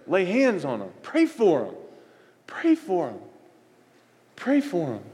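high-pitched, punchy exhortation to pray; fervent preaching. Acted. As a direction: preach it hard, high bursts falling at each end. Preaches at full force.

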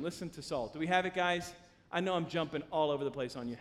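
normal talking voice

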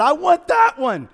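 high, loud mock parent picking a baby; pretend parent choosing a baby. Imagined parent choosing a sibling, high loud mock eagerness. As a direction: play an eager parent pointing one out. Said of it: "nobody before you were born said".

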